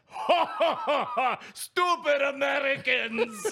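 mocking laugh. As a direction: laugh down at them. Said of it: "or they laugh like".

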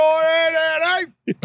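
high, mock English constable; hammed-up English policeman call; responsible constable. Shown as a demonstration. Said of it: "that's my entire constable".

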